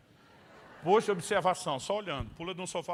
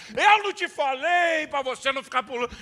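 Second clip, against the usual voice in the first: higher, louder scolding; scolding sermon; 7 semitones higher, 9 dB louder than usual. Retold or imitated. Father lecturing his fallen son, loud and stern. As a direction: scold the kid hard. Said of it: "Dei sermão".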